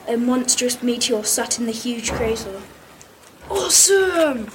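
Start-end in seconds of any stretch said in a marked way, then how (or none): 3.5-4.5 high, drawn-out cheer; excited shout. A narrator's reading. Pupil voices John seeing the crater, delighted shout. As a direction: stretch it out in awe, raised pitch. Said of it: "shouted John".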